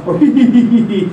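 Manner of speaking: slow rhythmic belly laugh on ha and he; laughing demo. Shown as a demonstration. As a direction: laugh out the ha and he sounds. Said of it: "use ha and he".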